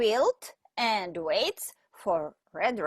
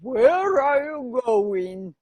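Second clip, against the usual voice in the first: slow, louder big bad wolf; 6 dB louder than usual. Wolf asking the little girl where she goes, slow and sly. Wolf voiced in read-aloud story.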